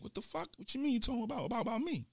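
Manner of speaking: high, hushed disbelief; startled disbelief. Retold or imitated. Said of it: "I'm like".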